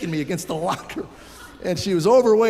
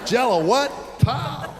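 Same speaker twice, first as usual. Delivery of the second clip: sharp, higher indignant snap; mock outraged teacher; 6 semitones higher, slower than usual. Miss Stokesbury snapping at the boy, mock outrage. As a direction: play her outrage then the hit. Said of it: "the wrong thing to say to Miss Stokesbury".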